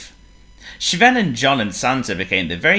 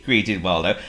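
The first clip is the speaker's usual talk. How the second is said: ordinary delivery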